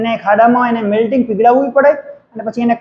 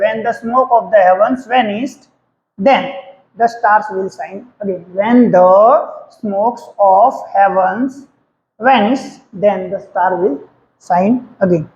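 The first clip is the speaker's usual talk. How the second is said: slow dictation; 3 semitones lower, much slower than usual. Shown as a demonstration. Teacher dictates answer slowly.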